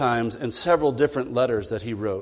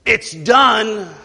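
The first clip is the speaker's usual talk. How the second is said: high, louder, grudging "it's done"; sulky reply; 7 semitones higher, 6 dB louder than usual. Acted. Sulky child tells the parent the room is clean. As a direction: toss it off like a resentful kid.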